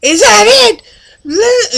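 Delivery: high, loud shouted is that it; outraged grandma yelling at the kid; grandma shouting. Retold or imitated. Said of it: "stopped for a minute and go".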